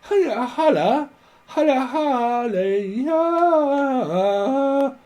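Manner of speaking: high, slow, stretched hiya holla; hammed-up slow spirit greeting; drawn-out mock greeting. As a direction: sing it out slowly like a caller. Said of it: "I'm hearing someone's like".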